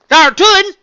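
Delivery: loud, high country exclamation; proud outburst. A narrator's reading. Farm pony boasting to her unicorn friend, proud outburst. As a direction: burst out with country pride. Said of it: "she burst out".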